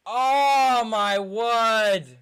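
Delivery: loud despair. Real, not acted. Fan reacting to defeat.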